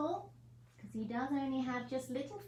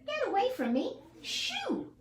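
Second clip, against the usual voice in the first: much higher squeaky rabbit shooing; high, louder rabbit panic; startled rabbit; 4 dB louder than usual. Acted. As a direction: recoil from the spikes and shoo him off. Rabbit Lou recoils from spikes.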